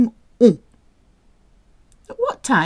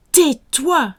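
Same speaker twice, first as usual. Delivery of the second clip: higher, sharp order; mock stern command; 7 semitones higher, 4 dB louder than usual. Shown as a demonstration. Teacher repeating tais-toi crossly for learners. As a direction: tell them off crisply.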